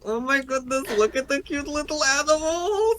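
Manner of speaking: very high-pitched, gushing character voice; squeal-coo in a put-on character accent; Marway. Player retelling slipping into character at cute squirrels, high gushing voice. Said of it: "I just said that like Marway".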